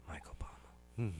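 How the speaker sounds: unvoiced whisper. Real, not acted. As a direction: whisper the name secretly. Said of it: "I have to whisper it".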